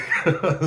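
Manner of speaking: loud, high burst of laughter; real laugh at his own story. Real, not acted.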